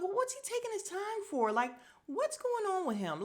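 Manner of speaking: high-pitched impatient questioning; exasperated disbelief. Retold or imitated. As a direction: let the pitch soar, baffled and impatient. Impatient reaction to the crying man.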